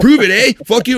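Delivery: indignant. Retold or imitated. Host mimicking the accused Canadian curler, mock indignant. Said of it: "hear him get all indignant".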